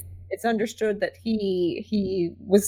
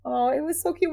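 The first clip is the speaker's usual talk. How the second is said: ordinary talk, nothing marked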